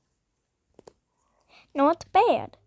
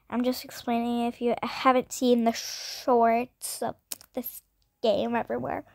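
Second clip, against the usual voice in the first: ordinary delivery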